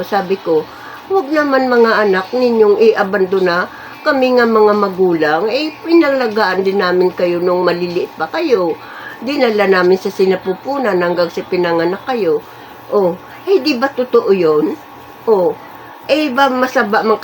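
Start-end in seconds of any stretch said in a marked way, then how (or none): none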